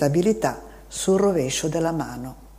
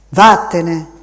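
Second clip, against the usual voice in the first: curt dismissal; 9 dB louder than usual. A narrator's reading. Man sends the boy away.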